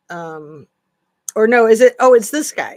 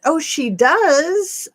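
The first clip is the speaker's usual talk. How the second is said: slow, higher, louder surprise; surprised; 8 semitones higher, 6 dB louder, much slower than usual. Real, not acted. Host learning news from a viewer comment, slow surprised. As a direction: draw it out, genuinely taken aback.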